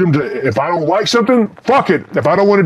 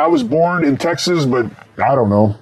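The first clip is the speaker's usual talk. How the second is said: ordinary delivery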